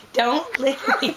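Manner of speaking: giggling protest; being tickled. Real, not acted. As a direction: laugh while pushing away. Being licked, laughing.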